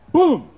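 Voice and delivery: a sudden high shouted boom; boom sound effect. Retold or imitated. Speaker bursting a door open, loud shouted boom.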